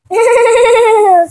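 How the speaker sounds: loud high forced laugh; fake laugh. Kayla covering her slip with a loud fake laugh. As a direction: ham up a phony cackle. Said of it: "She was fake laughing".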